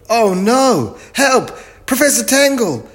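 very high, loud cries for help; panicked calling. A narrator's reading. Biff calls the professor about rocks, high panicked shout. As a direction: call out in alarm, pitch right up. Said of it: "she called".

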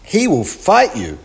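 high, loud warning; storybook cry of alarm. A narrator's reading. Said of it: "she cried".

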